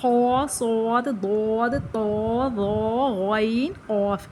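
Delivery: خا، صاد، ضاد slowly, full and thick; heavy letters recited. Shown as a demonstration. Teacher slowly recites the heavy letters for the students. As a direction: recite طا، ظا، غین، قاف with a heavy mouth. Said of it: "وہ یہ ہیں".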